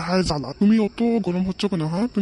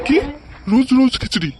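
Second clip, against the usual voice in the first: louder, higher disbelieving cry; a son shocked at khichuri yet again, louder; outraged surprise; 5 semitones higher, 5 dB louder than usual. Acted. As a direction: throw it back in disbelief.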